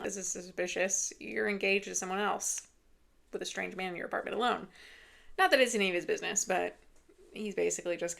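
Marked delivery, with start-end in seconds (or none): none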